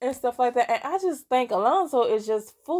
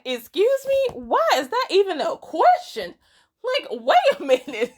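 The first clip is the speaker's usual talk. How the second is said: much higher, louder incredulous outburst; shocked disbelief; 11 semitones higher, 5 dB louder than usual. Retold or imitated. Vivi to the stranger who asks how the man should die, shocked high outcry. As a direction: recoil as if the question is obscene. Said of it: "Vivi's like".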